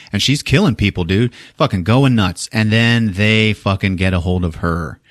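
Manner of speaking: plain reading voice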